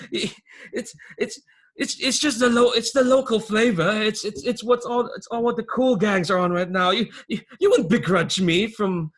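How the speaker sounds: high, loud, stammering crook; wheedling underling. Acted. Phineas excuses his snuff habit to the boss, wheedling. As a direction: plead your excuse in a high voice, tripping over words.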